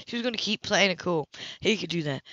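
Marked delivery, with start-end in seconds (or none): none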